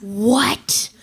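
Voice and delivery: hushed, airy gasp of a word; stunned disbelief. Acted. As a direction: breathe it out in astonishment. Stunned by the job offer.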